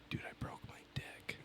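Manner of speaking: unvoiced, hushed confession; whisper. Patient whispering his injury to the triage nurse. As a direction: whisper it so nobody else hears.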